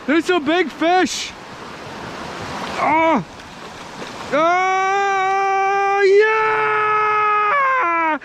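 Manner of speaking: high-pitched thrilled yelling; excited shouting. Real, not acted. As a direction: whoop like you just landed it. Landing a trout, celebrating.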